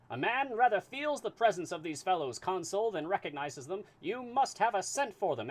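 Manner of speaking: put-on high, loud transatlantic accent; booming high transatlantic detective; transatlantic voice for Fix. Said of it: "need a voice for Fix".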